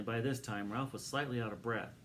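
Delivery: ordinary delivery, nothing marked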